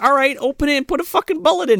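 high, fast impatient voice; mock exasperated girl. Girl instructing Nick how to load, high and fast. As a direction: rattle it off fed up. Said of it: "She's like".

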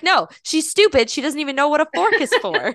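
retold outraged rebuttal; imitating upset kid. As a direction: insist indignantly, slowing on the first words. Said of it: "was very upset".

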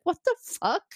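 high, bewildered what the fuck; stunned by what just happened; baffled. Retold or imitated. Troi left standing in the hallway, baffled high exclaim.

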